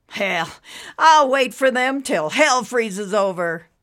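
defiant waiting dad; stubborn father. Acted. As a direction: dig in, he won't budge. Man vows to wait.

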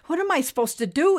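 high, quick, fretful question; trying not to panic. A narrator's reading. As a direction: blurt it fast and high, holding back panic. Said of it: "trying not to panic".

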